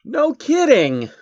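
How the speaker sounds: high, slow sarcastic jab; high drawn-out sarcastic aside. Real, not acted. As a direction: drag it out in mock disbelief. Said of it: "A satirical piece".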